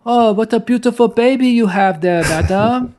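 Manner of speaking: high, hammed-up French accent; Gerard Depardieu French accent. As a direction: put on a big French accent. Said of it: "Your French accent is getting better".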